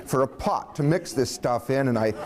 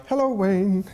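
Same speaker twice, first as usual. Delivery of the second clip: higher, lilting passing hello; nurse's greeting; 6 semitones higher, slower than usual. Retold or imitated. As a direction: voice a nurse greeting her patient, pitched up.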